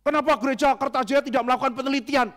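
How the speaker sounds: high, loud indignant demand; mock accusing question. A critic grilling the Kertajaya church, high and accusing.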